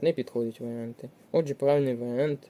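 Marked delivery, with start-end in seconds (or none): none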